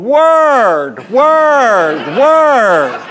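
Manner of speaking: high, stretched-out taunting repeats; inner voice. Retold or imitated. Pitcher's inner self itching to defy umpire, drawn-out mock taunt. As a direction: let each one swoop up and hang. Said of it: "a guy on the inside of me that was going".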